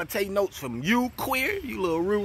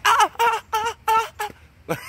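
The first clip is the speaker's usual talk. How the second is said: mock moans pitched much higher and louder, breaking into a shrieking laugh; mock high moaning into a laugh; 4 dB louder than usual. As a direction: ham up high-pitched moans until you crack up. Mocks the moaning, then laughs.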